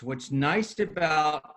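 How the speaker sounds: normal talking voice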